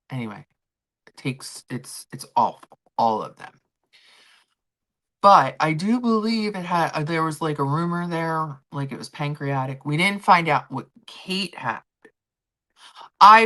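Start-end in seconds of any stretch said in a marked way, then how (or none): none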